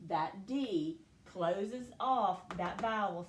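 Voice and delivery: ordinary talk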